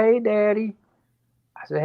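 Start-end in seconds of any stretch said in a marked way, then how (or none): 0.0-0.7 daughter's greeting. Retold or imitated.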